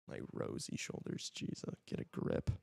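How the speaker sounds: whispered aside to himself; under the breath, unvoiced; whispering. Real, not acted. Mutters to himself after self-remark.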